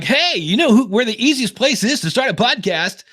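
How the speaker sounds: high, hammed-up radio announcer; crappy radio voice. Podcaster mocking an ad he recorded for Anchor, crappy radio voice. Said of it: "the crappiest radio voice I could".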